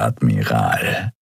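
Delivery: low, slow Admiral with a rising end; slow mocking greeting, low; smug greeting. A narrator's reading. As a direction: draw out Admiral low and slow, ending with a lift. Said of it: "sagte er zur Begrüßung".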